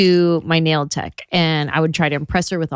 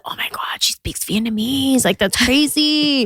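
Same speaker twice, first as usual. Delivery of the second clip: mock amazement; 6 semitones higher than usual. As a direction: imitate impressed onlookers, starting in a whisper. Retold amazed onlookers, partly whispered.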